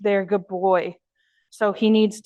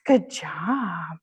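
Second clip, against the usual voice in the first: whispered praise; 4 semitones lower, 3 dB quieter than usual. Real, not acted. Quiet praise to dog.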